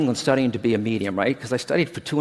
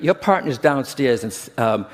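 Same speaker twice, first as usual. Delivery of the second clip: usual speaking voice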